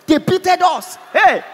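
high, loud, cocksure fan shout; hammed-up confident football boast; confident sports shout. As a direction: imitate a fan arguing sports at full confidence. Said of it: "With confidence".

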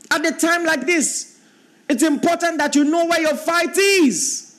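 high, ringing pulpit voice; preacher pressing the point on the congregation; preaching at full force. Acted. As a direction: keep it lifted and urgent. Preacher holding high pitch.

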